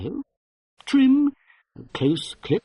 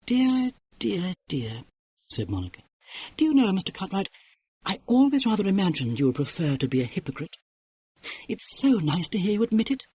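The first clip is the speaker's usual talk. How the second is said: wicked smile; 5 semitones higher than usual. Acted. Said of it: "Monica smiled wickedly".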